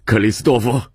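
father's urgent call across the room. Acted. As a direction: cry out after the departing son. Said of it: "曼西沃却叫了声".

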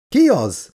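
high-pitched alarmed question; crying out. A narrator's reading. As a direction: call it out urgently. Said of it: "kiáltott fel Elizabeth is". (magas hangú felkiáltás)

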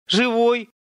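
high, loud call of relief; a loud cry of relief; shouted. A narrator's reading. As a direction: shout it out across the road. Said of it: "крикнула она Виктору".

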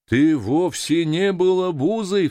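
slow, high, loud protest; crying out. A narrator's reading. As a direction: exclaim it emphatically to reassure him. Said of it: "вскричал моряк-земледелец". (громкое, горячее возражение)

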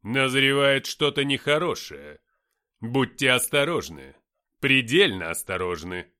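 loud, slow, ominous proclamation; menacing voice. A narrator's reading. Lucifer warning the men of danger, menacing voice. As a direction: boom it out slowly as a warning. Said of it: "грозным голосом".

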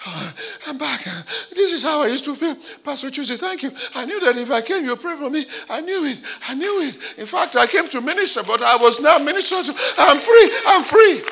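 high, laughing, breathless thanks building to loud cries; imitating a freed man. Freed man thanking Pastor Chuzi, high elated voice building loud. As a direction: gush with relief and joy.

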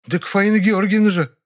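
high-pitched, taken-aback woman; flustered. A narrator's reading. Nyura finally names her mistress, flustered. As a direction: exclaim it, thrown by the question. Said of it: "Даже растерялась она".

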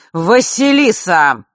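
loud, slow furious call; angry bark. A narrator's reading. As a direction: bark her name across the room. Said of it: "рявкнул Нортон-старший". (отец рявкает на дочь, громко и гневно)

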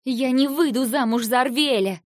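flaring up. Acted. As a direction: explode at the very idea of it. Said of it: "взвилась я". (вспылив, громко и выше)

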